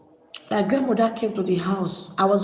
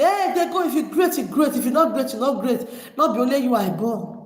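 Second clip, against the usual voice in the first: high-pitched scoffing retort, easing down towards the end; mock her boast in a raised, mimicking voice; imitated dismissive mother; 4 semitones higher than usual. Mother brushing off daughter's dream of greatness, imitated scoffing.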